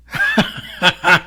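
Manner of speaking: short crazed laugh; unhinged burst of laughter; manic laugh. Acted. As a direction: laugh as the mind cracks. Mad laugh before denial.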